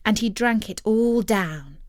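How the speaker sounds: ordinary delivery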